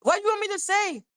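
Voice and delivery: high, fast exasperated shout; exasperated outburst. Retold or imitated. Venting at viewers about the case in an exasperated shout. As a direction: throw up your hands and yell it. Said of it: "I'm like".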